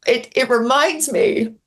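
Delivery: fighting back tears on the words; real tears welling as she repeats it; choked up. Real, not acted. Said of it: "I'm getting emotional".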